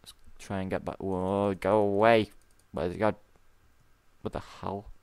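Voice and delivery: ordinary talk, nothing marked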